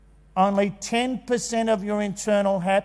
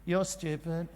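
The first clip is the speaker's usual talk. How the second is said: much softer muttered comeback; sulky little brother; 3 semitones lower, 11 dB quieter than usual. Retold or imitated. Little brother answering big brother's insult, quiet and sulky. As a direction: answer back under your breath.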